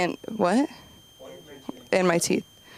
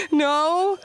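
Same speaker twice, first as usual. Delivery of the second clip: laughing; 10 semitones higher than usual. Real, not acted. Crowd work, awkward silence.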